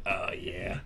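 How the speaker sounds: sleazy voice. Shown as a demonstration. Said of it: "That fucking voice".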